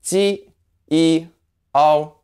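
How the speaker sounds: "ji, e, o" as separate held sounds; syllable breakdown. Shown as a demonstration. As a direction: sound out "ji, e, o" piece by piece, high and level. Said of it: "So altogether you have".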